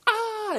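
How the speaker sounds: sharp high cry of disgust; short shocked exclamation. Shown as a demonstration. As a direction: yelp "아" like something awful. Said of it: "끔찍하니 뭐예요?".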